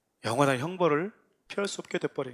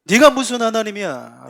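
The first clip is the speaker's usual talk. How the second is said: loud, higher-pitched sarcastic taunts; sneering, raised-voice ridicule of a supposed savior; jeering mockery; 5 semitones higher, 7 dB louder than usual. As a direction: throw each question out as a scornful challenge. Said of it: "라고 비아냥거렸다라는 거잖아요".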